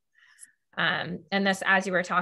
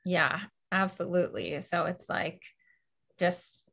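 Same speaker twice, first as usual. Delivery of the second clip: ordinary talk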